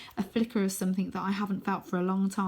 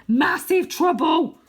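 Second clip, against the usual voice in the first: loud defiant shout; 9 semitones higher, 10 dB louder, slower than usual. Acted. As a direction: shout it right back at him. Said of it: "shouts Wynne".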